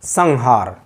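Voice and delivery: "संहार" said as sang-haar, with an ng; slow demo of "संहार" with ng before the h; velar nasal. Shown as a demonstration. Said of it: "सटीक इसका उच्चारण है". ("संहार" में ङ की ध्वनि)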